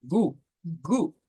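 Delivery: "Gu, gu" with a hard g, voiced clearly; German g sound. Shown as a demonstration. Teacher demonstrating the German g to Spanish speakers, careful and clear. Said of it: "de la, de la G".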